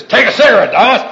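loud, high-pitched mock shout; hammed-up barracks bully shouting; imitated bullying demand. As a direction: act out a buddy yelling an order. Acted bullying in retold fight.

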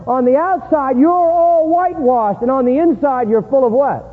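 high, emphatic sermon voice; impassioned preaching. Acted. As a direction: preach the rebuke with force. Preaches Jesus' rebuke forcefully.

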